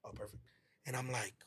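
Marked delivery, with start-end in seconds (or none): none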